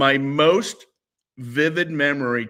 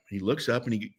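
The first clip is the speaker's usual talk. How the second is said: usual speaking voice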